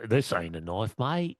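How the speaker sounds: a hint of an Aussie accent, quoting the film; Crocodile Dundee Aussie accent. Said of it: "You flying with Crocodile Dundee?".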